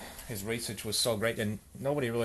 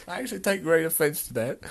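higher, hurt protest; hammed-up, pitched-up offense; wounded; 7 semitones higher, 4 dB louder than usual. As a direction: object as if cut to the heart. Repeats objection, hurt to the heart.

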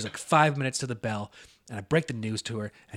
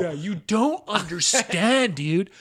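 loud, high, stretched-out stoner imitation; hammed-up stoner amazement; awestruck stoner; 6 semitones higher, 8 dB louder, slower than usual. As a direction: play a stoner in awe, dragging words out slowly. Stoner insists to the teller.

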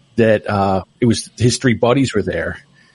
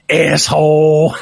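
slow mock yell from a small boy; drawled asshole shouted from the car seat; imitating little son; 3 semitones higher, 3 dB louder, much slower than usual. Little son in the back seat copying his mother's curse. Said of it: "I hear my son go".

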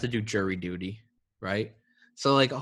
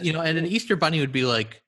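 ordinary talk, nothing marked

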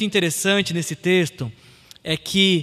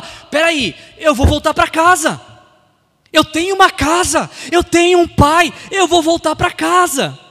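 higher, louder determined declaration; acted resolve; 6 semitones higher, 5 dB louder than usual. Prodigal son deciding to go back home, loud resolve. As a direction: declare the decision boldly. Acts son's sudden resolve.

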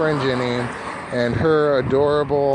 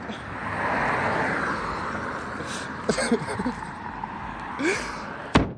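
breathy high laugh; 7 semitones higher, 7 dB quieter than usual. Real, not acted. Laughs at own joke.

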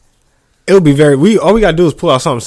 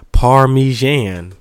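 drawn-out mock British Parmesan; 4 dB louder, much slower than usual. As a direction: say Parmesan slowly in a posh London put-on. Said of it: "You sound like you're from London".

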